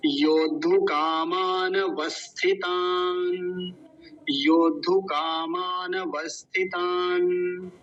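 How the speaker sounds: "योद्धु कामान वस्थितान" slow, linked, last syllable held; parts joined. Shown as a demonstration. Teacher joins the broken-up word, slow linked demonstration. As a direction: sound out "योद्धु कामान वस्थितान" with stretched long vowels. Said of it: "जोड़ लेंगे".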